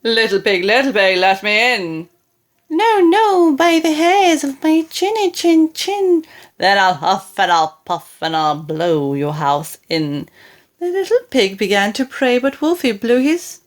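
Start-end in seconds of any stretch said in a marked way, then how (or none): 0.0-2.1 lightly voiced wolf at the door; a hint of the big bad wolf. 2.7-6.2 higher, louder squeaky pig; high piggy voice; little pig voice. Acted. 6.6-10.3 low menacing wolf. Acted.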